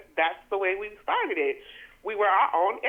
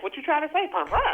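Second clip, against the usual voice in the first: playful question; 7 semitones higher than usual. Shown as a demonstration. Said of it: "It could be like".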